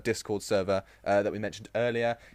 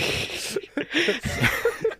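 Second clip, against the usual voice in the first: breathy laugh. Real, not acted. Laughing mid story.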